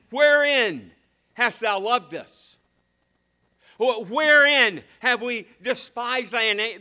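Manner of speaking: loud, high, indignant questions; preaching at full force. Acted. Preacher voicing the complaining people to God, shouting.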